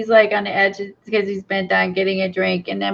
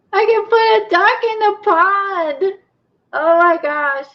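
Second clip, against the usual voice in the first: high, giddy squeal of delight; gleeful excitement; 11 semitones higher than usual. Real, not acted. Crafter putting a duck in a pond, gleeful. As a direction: gush it high, thrilled by the idea.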